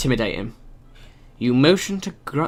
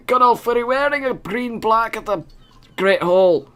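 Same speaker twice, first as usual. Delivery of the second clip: companion voice; 7 semitones higher than usual. Acted. Voicing companion character.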